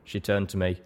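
usual speaking voice

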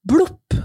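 high, loud spoken 'blop'; popping sound effect. A narrator's reading. Muscle springing from Ivan's arm, high comic pop. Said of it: "sa det".

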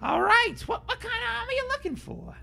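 very high squeaky frog; excitable tiny frog merchant. Acted. Frog shopkeeper voice.

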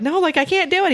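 high-pitched imitated protest; retold high-pitched helpless plea; protesting daughter. Daughter protesting to her dad.

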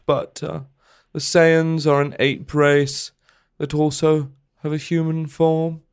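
a hint of Louis Theroux reading a letter; Louis Theroux impression. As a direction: read it like a mild Louis Theroux. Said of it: "I'll do, I'll do, uh, Louis Theroux".

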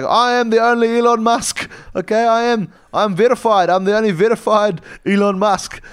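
high, loud insistent whine; indignant Elon Musk. Acted. Elon Musk shouting from his rocket helicopter, high and insistent. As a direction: insist you are the real one. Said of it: "He's like".

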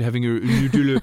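Nutella in a hammed-up French accent; mock French accent. Teasing co-host as a refined Parisian, mock French accent. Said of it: "growing up maybe in Paris".

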